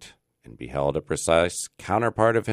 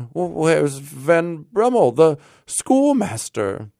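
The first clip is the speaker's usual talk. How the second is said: high, slow, louder question; anxious inquiry; 11 semitones higher, 5 dB louder, slower than usual. A narrator's reading. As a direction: call out slowly for a missing friend. Rip asks anxiously after another friend.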